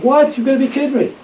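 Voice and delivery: taken aback, voice jumping up; incredulous protest. Retold or imitated. Officer just relieved of command answers his superior, incredulous.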